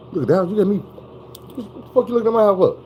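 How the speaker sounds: high, fast, furious mother; mock angry mom confronting strangers; mama snaps. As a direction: flip from sweet to outraged. Mama turns angry at buyers.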